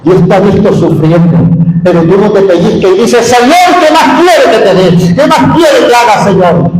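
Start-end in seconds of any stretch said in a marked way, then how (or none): 3.3-6.7 desperate plea. Retold or imitated. Believer crying out to God, asking what more he wants, in an anguished plea. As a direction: cry out as if at wit's end.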